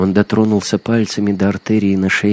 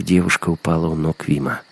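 plain reading voice

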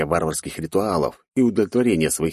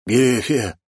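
raised, taken-aback call; stunned father; 6 semitones higher than usual. A narrator's reading. Father sees his rescued daughter, stunned call. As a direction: call the name in astonishment. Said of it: "опешил мужчина".

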